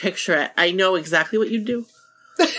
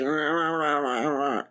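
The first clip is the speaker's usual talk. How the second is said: low, soft mock grumble of wordless protest; groggy grumbling imitation; 6 semitones lower, 7 dB quieter, slower than usual. Friend imitating Nikki refusing to get up, grumbling. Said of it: "you'd be like".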